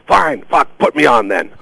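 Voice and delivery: high, exasperated mock outburst; imitated angry yell. Fed-up guest gives in to producer's request, imitated angry yell. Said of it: "he started yelling".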